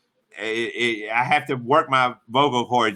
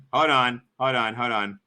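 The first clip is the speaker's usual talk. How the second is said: normal talking voice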